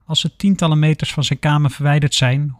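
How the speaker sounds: usual speaking voice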